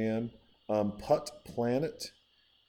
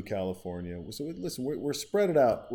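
ordinary talk

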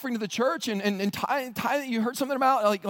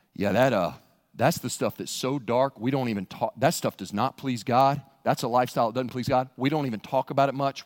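spooky guide; 9 semitones lower than usual. Acted. Guide warning the pilgrim about the dark path, ominous. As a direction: sound like a guide at a haunted trail.

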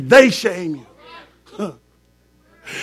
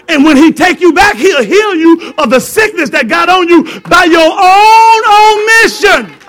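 preaching climax; 7 semitones higher, 6 dB louder than usual. Acted. Preacher shouting God's healing to congregation. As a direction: build to a shout.

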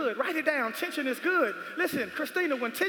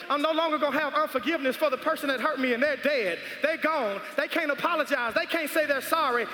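ordinary talk

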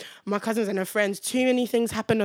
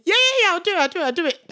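high, tumbling mock eagerness; retold eager volunteering; 8 semitones higher, 4 dB louder, faster than usual. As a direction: jump at the job, playing it up. Acts out own eager volunteering.

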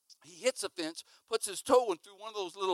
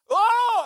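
high, loud panicked shriek; acted scream; 14 dB louder than usual. Boy in the dog's grip, high loud mock scream. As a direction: shriek as if being mauled. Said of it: "I'm screaming".

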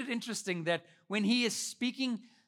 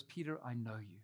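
very soft, low and knowing; quiet, intimate comfort; tender reassurance; 9 semitones lower, 10 dB quieter than usual. Acted. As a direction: say it gently, dropped right down. Jesus's understanding look put into words.

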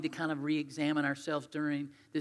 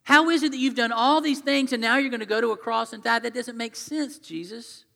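higher, louder baffled objection, tailing off on the name; imagined disciple protesting, pitch raised; confused protest; 6 semitones higher, 6 dB louder than usual. Acted. Confused follower questioning Jesus about the cross, baffled protest. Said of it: "I would be really depressed".

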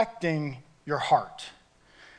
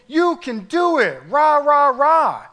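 higher, louder pom-pom cheer; mock cheerleader; 7 semitones higher, 6 dB louder than usual. Pastor mocking a cheerleader Jesus, loud and high. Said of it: "like a cheerleader with pom-poms".

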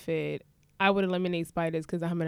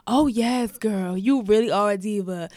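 louder imitated gushing vendor; hammed-up sassy praise; sassy hype woman; 4 dB louder than usual. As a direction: gush over her like a fan. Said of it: "she's like".

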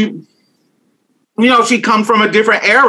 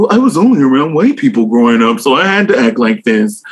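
a lightly put-on proper, whitewashed voice, a bit lower; 4 semitones lower than usual. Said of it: "gave you that kinda whitewash kinda".

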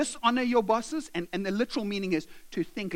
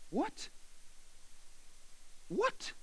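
high, soft rising disbelief; a small, stunned squeak of a question; acted quiet shock; incredulous; 8 semitones higher, 13 dB quieter than usual. Slave hears he must honor master.

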